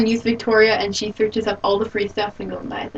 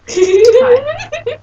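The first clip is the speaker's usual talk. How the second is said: very high hi breaking into laughter; failed serious hi; 13 semitones higher, 5 dB louder than usual. Pretend. Friend trying a serious greeting, cracks up laughing. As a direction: try to stay serious but laugh. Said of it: "I'll try and be really serious".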